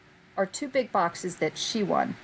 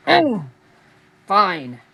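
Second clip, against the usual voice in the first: grudging; 8 dB louder than usual. Retold or imitated. Answering her mother's voice, a grudging loud fine. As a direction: give in like a sulky kid.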